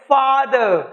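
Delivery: "Father" with फा stressed and drawn out; FAA-duh for "father"; stress on first syllable. Shown as a demonstration. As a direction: lean on फा in "father", let दर fall away. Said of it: "फा पे stress है".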